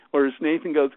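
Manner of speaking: ordinary delivery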